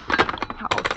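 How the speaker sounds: slow, breathy, under her breath; an insulted invalid muttering revenge on the operator; spiteful mutter. Acted. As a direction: hiss it to yourself, plotting. Muttering revenge after hanging up.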